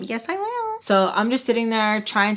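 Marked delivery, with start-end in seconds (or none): 0.0-0.7 eager dumb answer. Retold or imitated. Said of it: "And, like, me dumb".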